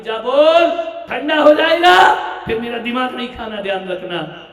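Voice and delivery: high, loud, fast scolding; mother yelling. Retold or imitated. Mother warns son food will go cold, loud scolding. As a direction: nag him at full volume. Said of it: "mummy कहती".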